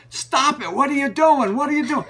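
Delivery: high, loud, outraged shopkeeper yell; retold yelling. Bookstore owner yelling at a customer to stop, loud and high. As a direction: bark it like an angry shop owner. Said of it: "yelling at me".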